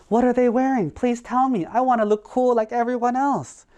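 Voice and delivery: high, eager pleading voice; eager bear. Acted. Bear begs the crow for city fashion tips.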